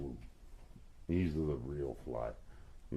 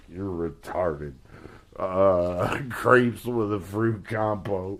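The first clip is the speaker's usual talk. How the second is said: louder and higher, laughing through the words; friends mocking, laughing; 5 semitones higher, 7 dB louder than usual. Friends laughing at daughter over crepes, mock scoffing. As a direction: laugh at her while mocking the complaint. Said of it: "all her friends were like".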